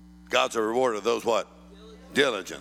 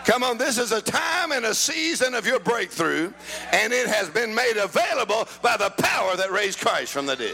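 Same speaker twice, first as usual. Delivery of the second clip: full-force preaching; 11 semitones higher than usual. Real, not acted. Preacher declares resurrection power to the congregation, full-force preaching. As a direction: preach it in emphatic, driving phrases.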